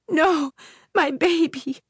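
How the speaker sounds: anguished, high and loud; crying out. Acted. Mother cries out as the witch takes her child. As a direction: cry out for the stolen baby. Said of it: "Nana cried out".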